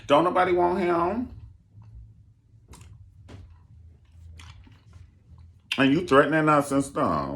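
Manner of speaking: loud, high, fast protest; indignant guest. Retold or imitated. Guest complaining to [name] she cussed out family, loud. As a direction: call her out across the room. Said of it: "I'm like".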